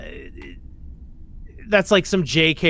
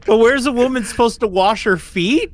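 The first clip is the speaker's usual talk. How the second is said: higher mock-Irish complaint; lightly voiced indignant Irish lady; imitated Irish woman; 6 semitones higher, 4 dB louder than usual. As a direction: complain as a put-upon Irish villager. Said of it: "And she's like".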